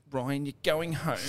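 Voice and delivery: high, slow put-on voice of a teammate; Chainsaw. Teammate sending a drunk mate home, high slow impression. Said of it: "That's exactly how he sounds too".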